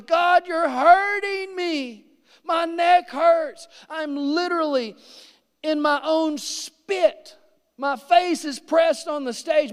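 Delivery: high, slow, near-sobbing plea; re-living agony, voice breaking on the stage; crying out in pain. Retold or imitated.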